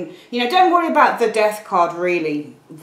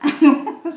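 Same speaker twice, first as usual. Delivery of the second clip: high, loud burst of giggles; laughing; 9 semitones higher, 6 dB louder than usual. Real, not acted. Sheepish over her old cardigan, laughing out loud.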